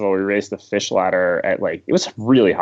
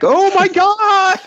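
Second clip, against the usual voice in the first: shrill high scream, drawn out slowly; screaming woman; 7 dB louder, much slower than usual. Retold or imitated. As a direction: imitate a horrified onlooker screaming at full pitch. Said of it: "this woman just screaming".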